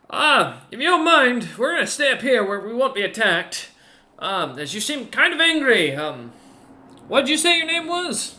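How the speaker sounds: high, loud ogre voice, wary and polite; ogre's voice. Acted. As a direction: speak down from the wall, wary but polite. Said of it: "looks back to Lottie and goes".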